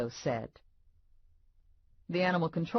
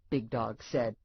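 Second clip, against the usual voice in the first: ordinary delivery, nothing marked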